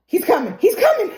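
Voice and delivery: rising into a high, loud cry; acted alarm. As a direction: warn them in mock panic. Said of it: "Fatima just freaking out".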